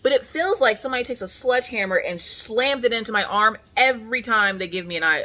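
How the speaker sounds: usual speaking voice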